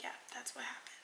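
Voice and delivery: soft whispered confirmation; whispered admission. Retold or imitated. Boyfriend quietly owning up to the punched headboard. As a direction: murmur it like you'd rather not say.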